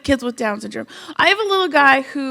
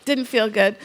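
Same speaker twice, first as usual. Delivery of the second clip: ordinary delivery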